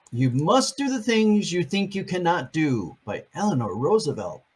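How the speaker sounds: ordinary delivery, nothing marked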